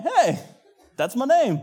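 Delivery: high, perked-up exclamation; pleasantly surprised. Acted. Customer hearing his name called correctly, pleasantly surprised. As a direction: pipe up as if suddenly recognized.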